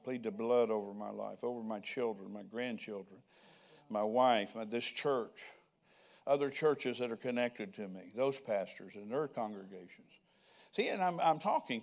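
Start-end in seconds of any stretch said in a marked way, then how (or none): 0.0-10.1 lower, earnest prayer spoken aloud. Acted. Preacher praying over his family, lower and earnest. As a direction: pray it low and steady, thanking Father.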